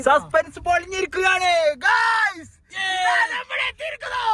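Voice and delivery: high, loud hype announcement; excited announcing. Real, not acted. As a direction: shout the big reveal to viewers. Vlogger announcing arrival excitedly.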